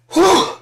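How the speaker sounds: loud squeaky sigh of relief; high relieved whew. Acted. Relieved experts after Donald won't release grades, high whew.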